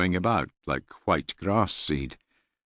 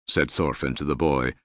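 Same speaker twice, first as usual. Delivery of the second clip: ordinary delivery, nothing marked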